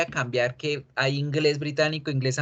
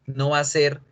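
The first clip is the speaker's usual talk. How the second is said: normal talking voice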